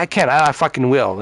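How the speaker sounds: normal talking voice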